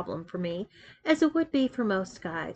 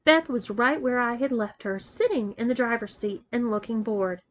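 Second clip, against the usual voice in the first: ordinary delivery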